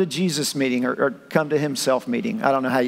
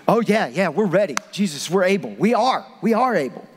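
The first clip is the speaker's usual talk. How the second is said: put-on immature kid; 2 semitones higher than usual. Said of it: "just like an immature kid".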